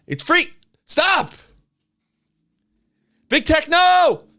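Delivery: high, very loud cry of protest; exasperated yelling. Real, not acted. Creeped out by his own talking voice, yelling. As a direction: yell at yourself to stop.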